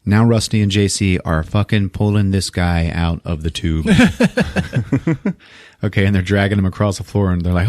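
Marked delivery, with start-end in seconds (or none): none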